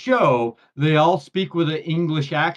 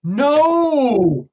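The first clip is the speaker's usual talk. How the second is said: long, loud, high-pitched wail of protest; mock anguished cry; 11 semitones higher, 7 dB louder than usual. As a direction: cry out as if the ending was spoiled. Mock horror at a spoiler.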